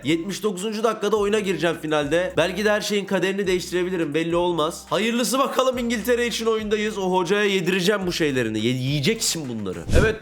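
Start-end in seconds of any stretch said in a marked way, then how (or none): none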